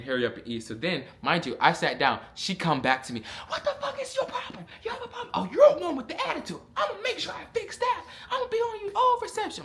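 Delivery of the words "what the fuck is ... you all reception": very high, mocking drill sergeant tirade; confrontational drill sergeant. Drill sergeant returns to seated trainee, high-pitched mock threatening rant. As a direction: imitate her laying into a trainee, pitched way up.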